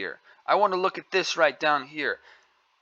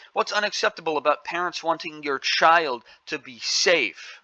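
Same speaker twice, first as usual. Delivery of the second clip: ordinary talk, nothing marked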